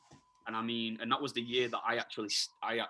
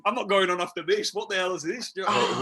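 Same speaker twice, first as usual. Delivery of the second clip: louder, higher mock outraged rock star; 7 semitones higher, 9 dB louder than usual. Imagined rock star backstage, fuming at the support act's music, in a hammed-up indignant voice. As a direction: huff it out like an offended headliner.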